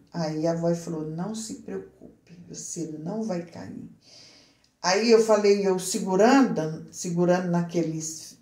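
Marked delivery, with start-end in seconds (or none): 1.1-3.9 hushed and gentle, much softer; calm the frightened woman softly; soft reassuring voice. Retold or imitated. Voz misteriosa tranquiliza a narradora. (voz suave e tranquilizadora)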